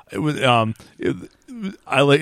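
ordinary delivery

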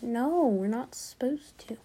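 unvoiced hushed warning; a secretive whisper. Real, not acted. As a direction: whisper it so nobody hears. Whispered warning about magic show.

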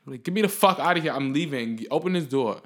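urgent burst. Retold or imitated. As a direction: snap out of the whisper into a frantic demand. Desperate to escape the house.

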